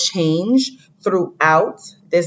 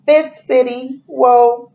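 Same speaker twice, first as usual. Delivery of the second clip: higher, louder "Whoa"; mock amazement; 7 semitones higher, 4 dB louder, slower than usual. Teacher showing wonder at the fifth city, loud mock whoa. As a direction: wow the class with the reveal.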